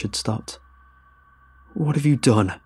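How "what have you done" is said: higher, louder furious demand; rage. A narrator's reading. Captain confronting the narrator, in rage. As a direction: confront him in furious disbelief. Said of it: "a rage more intense than anything".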